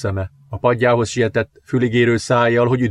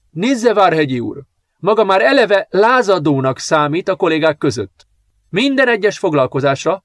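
higher, louder, firm headmistress voice; stern headmistress; 7 semitones higher, 3 dB louder than usual. A narrator's reading. Headmistress rebuking teacher Várhegyi, stern and firm. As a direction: lay down the law to a rebellious teacher. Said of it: "szigorú tekintettel határozottan válaszolt".

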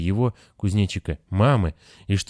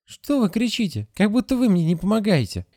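put-on much higher child voice; puzzled child; 12 semitones higher than usual. A narrator's reading. Grasshopper asks the nurse why she shouts, high and puzzled. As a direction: ask frankly like a small boy. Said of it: "boy questions nurse".

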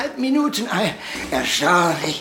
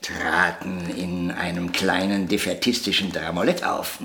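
ordinary delivery

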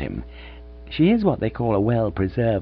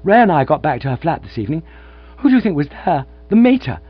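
elated rush; 7 semitones higher, 6 dB louder than usual. Acted. Gussie bursting with happy news.

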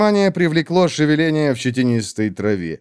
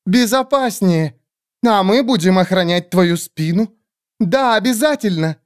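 much higher squeaky groveling bird voice; fawning creature; 8 semitones higher than usual. Acted. Skeletal bird creatures grovelling before the great witch, high squeaky.

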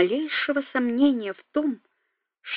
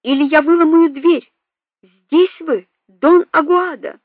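loud, higher, threatening shout; commanding shout; 5 semitones higher, 5 dB louder than usual. A narrator's reading. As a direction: demand it through the door. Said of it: "кричал снаружи громкий повелительный голос".

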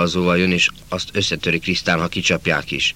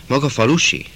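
put-on curious young girl; 8 semitones higher, 5 dB louder than usual. Girl Klári asks the boy.

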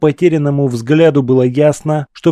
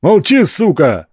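barked order; 5 semitones higher than usual. Acted. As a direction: snap it like a brutal thug. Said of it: "рявкнул крупный мужик". (грубый окрик)

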